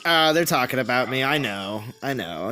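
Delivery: dropping low and soft, a lazy knowing mumble; mock her coolly shrugging it off; imitating her murmur. Friend imitating the absent streamer, low and soft. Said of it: "she's like".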